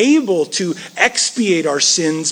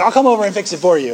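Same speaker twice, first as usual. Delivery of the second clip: eager know-it-all; 3 semitones higher, 4 dB louder, much faster than usual. Retold or imitated. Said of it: "they're like".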